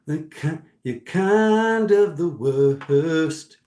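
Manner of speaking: drawn-out loud declaration; loud slow emphasis. Retold or imitated. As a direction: lean hard on the verdict, slowly. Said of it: "Spirit's like".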